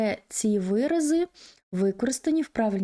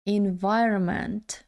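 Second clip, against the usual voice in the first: "Environment" run together more, still careful; quicker take; slower than usual. Shown as a demonstration. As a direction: join up the syllables of "Environment". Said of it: "Якщо дуже швидко". (швидша вимова "Environment", злито)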